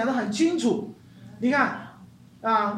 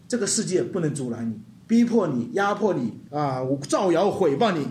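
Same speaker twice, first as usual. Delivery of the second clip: usual speaking voice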